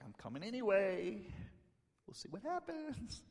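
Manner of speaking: high, airy, soft lilt at the end; playfully unconcerned Jesus. Retold or imitated. Jesus answers news of her death, playfully casual. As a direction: imitate someone blithely undeterred. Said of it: "Jesus is like".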